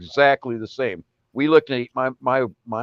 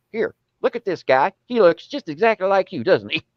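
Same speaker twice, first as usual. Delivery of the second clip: higher, excited Arkansas aunt voice; doing the aunt showing off her find; imitated Arkansas aunt; 9 semitones higher, 3 dB louder than usual. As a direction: Arkansas aunt, thrilled and pitched up. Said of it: "she's an Arkansasier".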